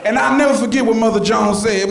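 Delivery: ordinary delivery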